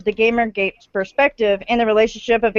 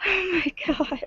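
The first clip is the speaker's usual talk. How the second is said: high, hushed whimper; mock despair; 7 semitones higher, 10 dB quieter, slower than usual. Real, not acted. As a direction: sigh it out in mock anguish. Dismay at age.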